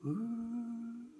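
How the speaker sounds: eerie ghostly wind whoo; wind sound. Retold or imitated. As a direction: moan like the wind. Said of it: "and the wind goes".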